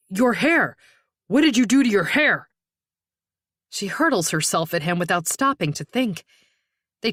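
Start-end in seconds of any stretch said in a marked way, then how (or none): 0.1-2.5 loud, accusing outburst; shocked outcry. Acted. Woman confronting her sister's attacker over his hair, crying out. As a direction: burst out in disbelief.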